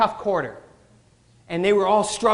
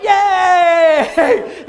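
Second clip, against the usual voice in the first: cheer; 8 semitones higher, 10 dB louder than usual. Retold or imitated. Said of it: "we're like".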